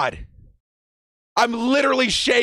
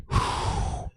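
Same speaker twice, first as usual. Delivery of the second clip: long voiceless blown-out breath; big exhale; 8 dB quieter than usual. Real, not acted. Fan recovering from celebrating, big sigh. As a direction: blow the air out after all the excitement.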